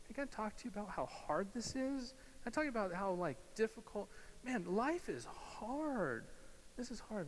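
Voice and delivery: high-pitched, acted venting to a companion; hammed-up complaint; acted complaining. Acted climber complaining to the guide.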